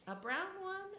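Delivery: lightly voiced collector; Stickler voice. A narrator's reading. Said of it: "said Stickler".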